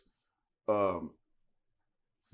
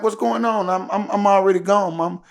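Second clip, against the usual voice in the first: alarmed reply; 8 semitones higher, 8 dB louder than usual. Retold or imitated. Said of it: "I'm like".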